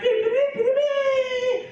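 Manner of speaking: very high, loud mock trumpet beeps; trumpet blast imitation. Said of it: "this loud trumpet blast".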